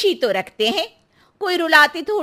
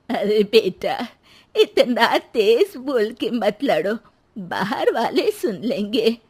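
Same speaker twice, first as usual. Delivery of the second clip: put-on much lower, slow old mother's voice; 7 semitones lower, slower than usual. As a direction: soothe the fighting couple as the old mother.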